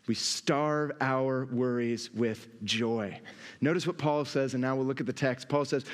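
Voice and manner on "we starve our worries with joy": slow emphatic restatement. Real, not acted. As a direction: lower and slow, weighing each word. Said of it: "Another way that we starve".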